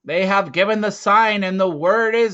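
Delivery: loud and high, a ringing proclamation; proclaiming leader. Acted. Volt declares the spirits' will to the hunters, loud and ringing. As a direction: announce it to the whole tribe.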